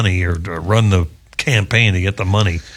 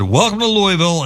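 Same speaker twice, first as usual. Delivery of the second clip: higher-pitched cabin welcome; flight attendant announcement; 10 semitones higher than usual. Retold or imitated. Flight attendant welcoming passengers on landing, higher-pitched imitation. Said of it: "the flight attendant said".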